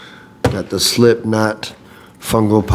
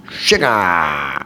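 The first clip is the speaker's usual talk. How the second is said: System of a Down way; 3 semitones lower than usual. Retold or imitated. Fan announcing the band's first single, drawn-out imitation. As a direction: belt out a long, sliding "shu-gaaah". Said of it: "way of saying sugar".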